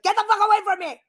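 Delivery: high, loud, fast outburst; exasperated yell. Acted. Woman fed up with men's questions, exasperated yell. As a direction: yell it in comic fury, rushing the words.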